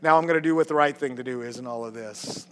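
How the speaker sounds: low, soft fatherly indulgence; God's fond reply. Retold or imitated. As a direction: pat a child on the head with your voice. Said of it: "he pats me on the head and said".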